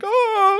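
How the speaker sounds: high yelp of fright; mock frightened cry. Dangling man yelping in mock fright. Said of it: "You're like".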